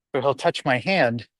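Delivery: ordinary talk, nothing marked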